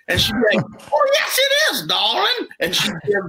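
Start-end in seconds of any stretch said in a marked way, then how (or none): 0.9-2.5 very high, hollered Cajun retort; high mock Cajun lady voice; Cajun accent. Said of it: "Cajuned up".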